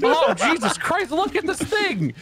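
very high, squealing indignation; comic outrage. Real, not acted. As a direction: wail your disbelief at this awful design. Outraged at badly made game snake.

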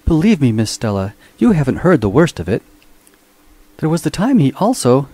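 a tattling mechanical butler leaning in to gossip; eager robot voice. A narrator's reading. Robot Minx eagerly tells Stella about his master.

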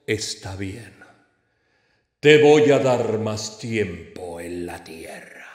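low, slow, solemn God; God's voice. Acted. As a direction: grant it slowly in a deep, godlike voice. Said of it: "el señor le dijo".

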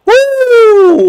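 very high, loud, held "woo"; excited whoop. Real, not acted. Gamer reacting to a big tackle, excited whoop. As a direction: whoop long and piercing.

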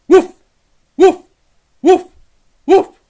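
high, loud yappy woofs; mock dog barks. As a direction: bark like an excited puppy. Dog barking.

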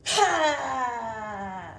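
loud forceful exhale, a big breathy ha; loud lion breath. Shown as a demonstration. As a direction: push all the air out in one loud ha. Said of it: "a lion's breath isn't screaming".

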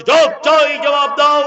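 high, loud rhythmic demand; slogan response. Acted. Second protester answering at the roadblock, shouted slogan. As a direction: shout back the protest refrain. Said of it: "আর একটা লোক বলছে".

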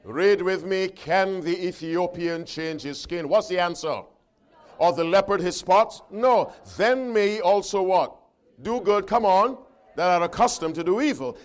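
loud, emphatic scripture reading; ringing pulpit delivery; forceful preaching. Acted. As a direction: proclaim the verse to the congregation, calling for answers. Preaches and reads scripture to congregation.

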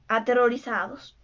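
plain reading voice

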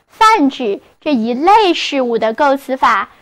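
normal talking voice

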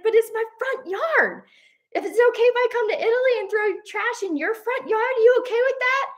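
high-pitched mock outrage; indignant challenge. Husband challenging the littering tourist, mock outrage. Husband arguing back, acted quarrel.

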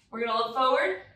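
ordinary delivery

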